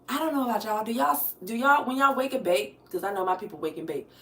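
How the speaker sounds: ordinary talk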